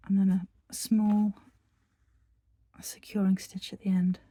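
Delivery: a calm whisper guiding the stitches. Acted. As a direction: murmur each step gently, like close-up ASMR. Whispered tutorial walkthrough.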